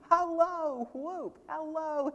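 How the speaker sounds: high, glad hello crying out; joyous cry. A narrator's reading. Scrooge crying out hello in high glee. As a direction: whoop it up like a giddy boy. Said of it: "he cried".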